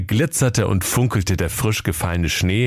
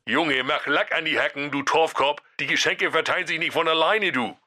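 higher, softer nagging grumble; Jakob grumbling; 6 semitones higher, 4 dB quieter than usual. Acted. Brother nagging Santa to get moving, soft high grumble. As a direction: nag him to hurry. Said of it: "Jakob Grimm meckerte".

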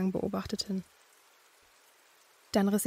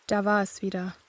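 ordinary delivery

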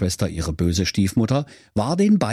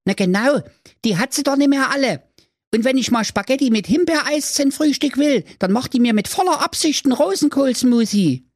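put-on much higher grumbling boy voice; little brother. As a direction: gripe like a cheeky little boy. Brudi figure.

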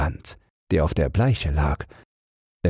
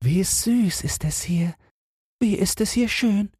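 high, sweet insect voice; delighted little ladybird. Acted. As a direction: marvel in a tiny high voice. Ladybirds admire the garden. (entzücktes Marienkäferchen, hohe Stimme)

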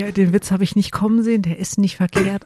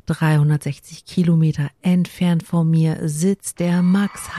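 normal talking voice

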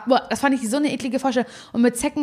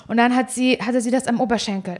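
ordinary talk, nothing marked

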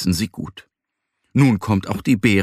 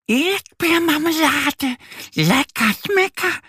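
delighted duckling. A narrator's reading. Duck Annie thrilled about jam, high squeaky glee. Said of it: "freut sich die Ente".

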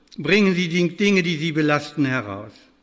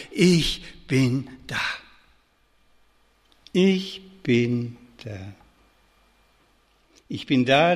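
mother's comfort; 4 semitones lower, slower than usual. Acted. Mother comforting frightened child.

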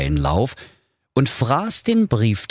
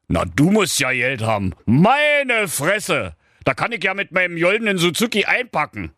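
higher, envious king; impressed king; 11 semitones higher than usual. Acted. King marvels at Kevin's cars, higher. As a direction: marvel and grumble.

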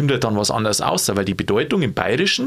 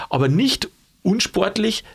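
"nicht" raised high and slowed, ahead of "unsportlich"; stress on nicht; 7 semitones higher, slower than usual. Shown as a demonstration. Explaining the Latin double negative to Sigi, hitting "nicht" slowly and high. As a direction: lean hard on "nicht" in "nicht unsportlich". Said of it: "ist dann eine besondere Betonung".

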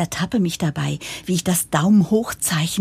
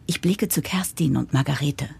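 normal talking voice

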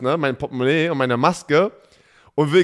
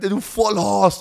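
higher, slower "du Vollhorst"; mild mock yell; retold insult; 6 semitones higher, slower than usual. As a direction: repeat the cyclist's insult, a bit milder. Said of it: "du, ja, du".

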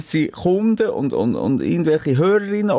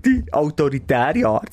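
ordinary delivery

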